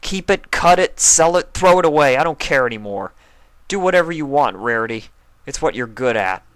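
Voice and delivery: high, loud furious yelling; angry shout. Acted. Spike yelling at Rarity over the gem, furious. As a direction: hurl each word at her. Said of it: "Spike was still so angry".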